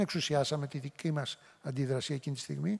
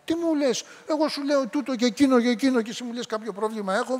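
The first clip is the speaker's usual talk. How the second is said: much higher, outraged comeback; indignant retort; 6 semitones higher than usual. Retold or imitated. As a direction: bristle with disbelief at the accusation. Angry man answers back. (αγανακτισμένη απάντηση, πολύ ψηλότερα)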